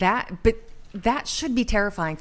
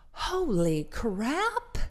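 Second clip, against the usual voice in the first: drawn-out, higher voice of a startled body; slow mock alarm; 5 semitones higher, much slower than usual. Immune system meeting a new virus, slow mock alarm. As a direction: stretch out "Holy crap" like the body panicking. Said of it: "it goes".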